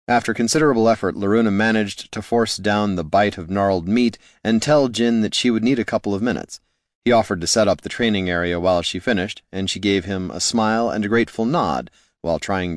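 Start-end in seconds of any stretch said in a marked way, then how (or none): none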